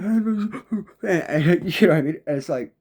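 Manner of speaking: high laugh with a cry in it; laughing through tears. Real, not acted. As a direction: laugh it off while choking up. Laughs and cries remembering.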